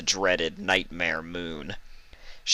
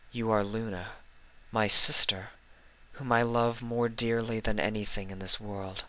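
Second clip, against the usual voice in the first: gentle cooing; 3 semitones higher than usual. Acted. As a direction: comfort your frightened sister. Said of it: "Celestia's gentle voice cooed".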